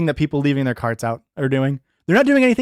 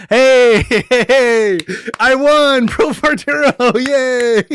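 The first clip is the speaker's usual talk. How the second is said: excited cheer; 6 semitones higher, 5 dB louder, much slower than usual. Real, not acted. Cheering after winning a sub.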